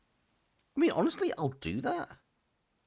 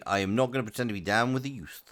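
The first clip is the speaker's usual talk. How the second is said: ordinary talk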